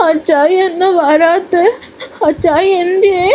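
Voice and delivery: put-on high, slow toddler whimper asking for her father; a little girl fretting, on the edge of tears; whiny crying child. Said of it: "രുദ്രയുടെ കരച്ചിലും ചിണുക്കവും".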